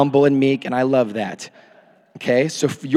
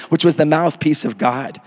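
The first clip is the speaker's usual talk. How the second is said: usual speaking voice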